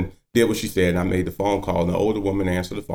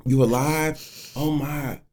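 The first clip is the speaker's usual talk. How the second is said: lightly voiced old man, higher in wonder; amazed old man; 8 semitones higher than usual. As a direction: imitate his moved disbelief.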